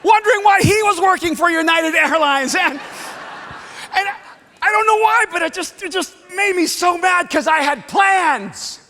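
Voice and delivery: loud, heated retelling; angry. Real, not acted. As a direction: let the anger back in as you tell it. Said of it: "And I was so mad".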